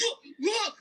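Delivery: high, eager look look; excited urging. Retold or imitated. Big Val urging him to look.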